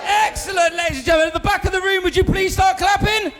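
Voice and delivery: big booming build-up to the act; hype-man intro. Real, not acted. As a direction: whip the crowd up, announcer style. MC hyping crowd, introducing act.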